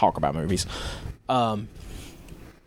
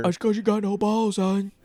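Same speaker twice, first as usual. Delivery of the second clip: mock hard-man taunt, pitched up; tough guy voice; 7 semitones higher than usual. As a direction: play a cocky brawler. Imitating a character from Scum.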